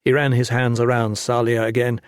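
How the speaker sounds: usual speaking voice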